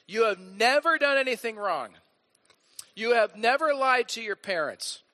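high, loud, mock-disbelieving challenge; exasperated preacher disbelief; incredulous indignation. Real, not acted. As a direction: call out the lie, half laughing. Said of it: "kinda laughing and kinda angry at the same time".

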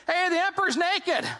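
put-on high, blurting child's call; little kid. Little kid at the emperor's parade, high blurted call. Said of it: "this one little kid goes".

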